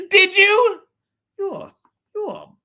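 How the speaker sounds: very high squeak of disbelief; high-pitched mock outrage; mock shock. Real, not acted. Shocked at viewer's comment.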